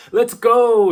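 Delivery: very loud, high-pitched yell; mock rallying cry, voice thrown up high; flustered battle cry. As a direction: shout it out, imitating a flustered warrior. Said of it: "he looks kind of flustered".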